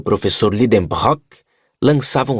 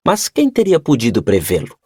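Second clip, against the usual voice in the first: plain reading voice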